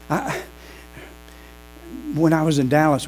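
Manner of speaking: usual speaking voice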